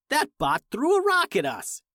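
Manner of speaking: astonished yell at the window; shocked. A narrator's reading. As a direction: blurt it out in shock. Finn shocked by rock attack.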